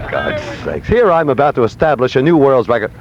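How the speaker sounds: ordinary talk, nothing marked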